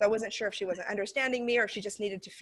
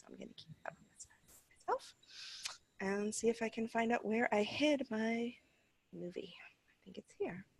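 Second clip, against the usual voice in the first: quietly muttering to herself while searching; half-whispered to herself; muttered aside; 2 semitones higher, 7 dB quieter than usual. Real, not acted. Teacher searching her files, muttering under her breath.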